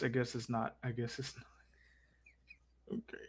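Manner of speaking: fading into a whisper; muted concession, dwindling to breath; sheepish backing down. Acted. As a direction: concede very softly, trailing into a slow whisper.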